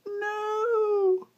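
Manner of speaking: high, wailing mock sob; hammed-up crying no, no. Reliving a favorite character's death with mock wailing. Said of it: "I was like".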